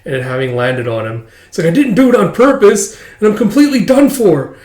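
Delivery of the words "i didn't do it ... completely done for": put-on high, loud, overwrought boy; panicking schoolboy. Blond kid confesses his booger mistake, panicking. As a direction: wail the confession in mock despair.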